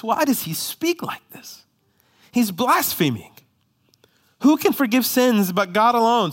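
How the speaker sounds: high, scandalised voice of the scribes; religious scholars bristling and outraged; indignant scribes. Retold or imitated. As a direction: sound shocked at the blasphemy. Scribes questioning Jesus in their hearts.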